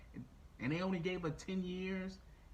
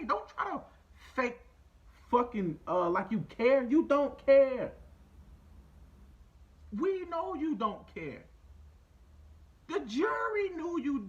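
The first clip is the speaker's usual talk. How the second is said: loud, higher, slowing to hammer the words; furious lecture, building to a peak then slowing; angry rant; 4 semitones higher, 6 dB louder than usual. Real, not acted. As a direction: tear into him like he's in the room. Ranting angrily at Kyle directly.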